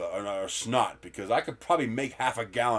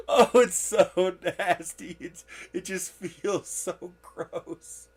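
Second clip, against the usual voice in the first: laughing disgust; 6 semitones higher, 6 dB louder, much slower than usual. Real, not acted. Disgusted laughing about cold snot.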